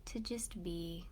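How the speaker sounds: hushed, unhurried guide, settling listeners inward; guided meditation. Real, not acted. Host guiding online listeners to close their eyes and breathe, guided meditation.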